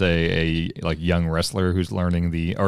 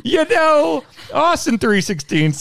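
high, slow mock wrestler hype; wrestling promo voice; slower than usual. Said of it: "all the great ones started with".

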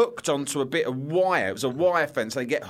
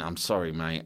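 sheepish apology; 9 semitones lower, 5 dB quieter than usual. Retold or imitated. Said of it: "and I went".

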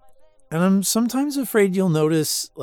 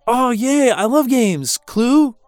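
excited; 6 semitones higher, 7 dB louder than usual. Real, not acted. Drunk excitement over games.

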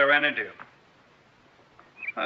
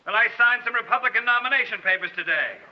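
defiant taunt; 10 semitones higher than usual. Acted. Adam taunting Philo with a gun.

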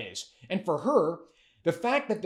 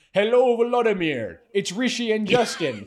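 higher, louder mock Ukrainian accent; fake Ukrainian accent; 3 semitones higher, 4 dB louder than usual. Co-host mocking a leader's video greeting, in a fake Ukrainian accent. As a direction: imitate a politician's put-on Ukrainian accent. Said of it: "the Ukrainian accent when he says".

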